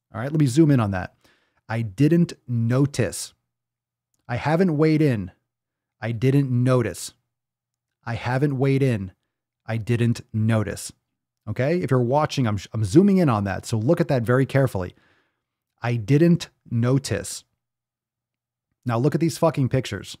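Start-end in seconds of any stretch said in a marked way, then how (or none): 1.7-10.9 I didn't notice, each "didn't" dragged out slow and leaned on; slow mocking reread. Rereading a follower's post to viewers, slow mocking stress. 15.8-17.4 I didn't notice, each "didn't" dragged out slow and leaned on; slow mocking reread. Rereading a follower's post to viewers, slow mocking stress. Rereads the claim once more.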